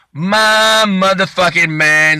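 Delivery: high, very slow drawn-out hail; adoring fan. Retold or imitated. Suspect promising the star he'll be good, slow high adoring holler. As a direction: stretch it out in mock adoration.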